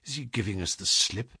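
hushed, suspicious question, very soft; whispered. A narrator's reading. Holmes suspecting the man is slipping away, whispered. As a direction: ask it under your breath. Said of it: "whispered Holmes".

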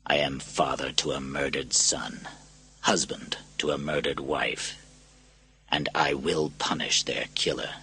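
low, soft, measured vow; quiet, grave threat with pauses between phrases. A narrator's reading. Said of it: "spoke more quietly".

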